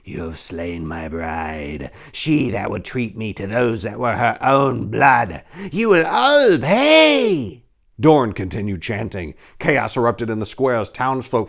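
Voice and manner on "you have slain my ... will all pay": very slow, booming devil voice; devil proclamation. Acted. Devil threatening the paladins, slow and menacing. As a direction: proclaim doom like a demon. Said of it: "The devil proclaimed".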